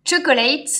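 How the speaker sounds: a playful, slightly exaggerated English accent on the product name; light tongue-in-cheek English accent. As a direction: say the name like a mock English speaker. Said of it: "avec mon super accent anglais".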